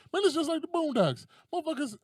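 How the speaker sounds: high, loud exclamation; mock excited commenter. Commenter compares clip to Boondocks, mock excited.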